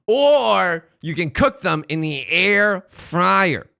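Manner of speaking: loud, drawn-out big announcement; grand reveal. Real, not acted. Streamer unveiling the air fryer option, slow and loud. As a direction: stretch each word out for a triumphant reveal.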